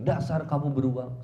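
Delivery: mock marital insult, punched out; acted angry wife. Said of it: "istrinya ketika bilang".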